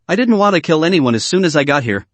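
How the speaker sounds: usual speaking voice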